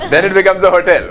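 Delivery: laughing. Real, not acted. Amused by the card's back.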